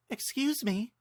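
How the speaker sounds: high, politely curious stranger; a stranger on the ship politely asking; woman traveler. Acted. Woman on the ship asking Link, high and polite.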